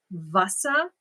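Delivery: Wasser with a German V sound, VAH-ser. Shown as a demonstration. Teaching viewers German, Wasser as VAH-ser. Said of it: "even though it's spelled with a W".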